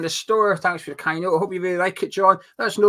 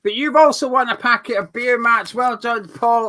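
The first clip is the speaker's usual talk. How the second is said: excited winner announcement; 7 semitones higher, 7 dB louder than usual. Real, not acted.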